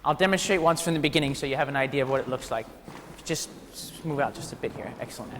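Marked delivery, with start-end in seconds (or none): none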